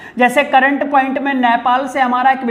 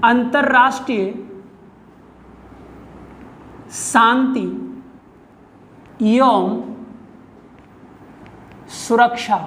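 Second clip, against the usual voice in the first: word-by-word dictation. Shown as a demonstration. Teacher giving students an article heading to write, word-by-word dictation. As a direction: dictate word by word, long pauses between words.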